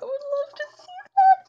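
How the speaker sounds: very high, loud fangirl squeal; excited squeal. Real, not acted. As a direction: squeal it with delight. Gushing rises to a squeal.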